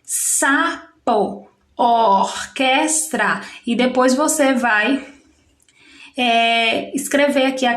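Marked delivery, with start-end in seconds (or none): 0.0-1.5 SAPO in two separate beats, sa, po; split into syllables. Shown as a demonstration. Teacher separating the next word for pupils, syllable by syllable. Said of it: "vai separar". 1.8-3.4 ORQUESTRA slow, in three beats or, ques, tra; split into syllables. Shown as a demonstration. Teacher separating the last word for pupils, syllable by syllable. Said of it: "vai separar".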